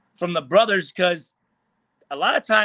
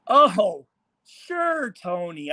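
sarcastic agreement; much slower than usual. Retold or imitated. Player retorting to a rival's request, sarcastic. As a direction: feign going along, dripping with sarcasm. Mocking comeback to Tony's request.

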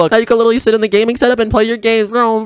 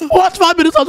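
panicked surprise; 11 semitones higher than usual. Acted. Human shocked only five minutes remain, much higher. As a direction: blurt it out in panic.

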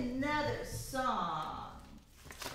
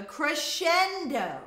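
CRESCENDO swelled louder on the middle syllable; swelling crescendo; 6 semitones higher, 6 dB louder, slower than usual. Shown as a demonstration. Teacher explaining quiet to loud, "crescendo" swelling. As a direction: let "crescendo" surge in volume. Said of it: "starts quiet and gets loud".

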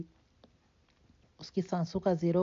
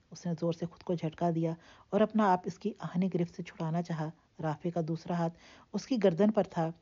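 ordinary delivery

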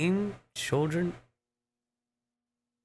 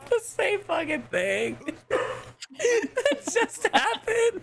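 laughing; slower than usual. Real, not acted. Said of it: "I'm crying".

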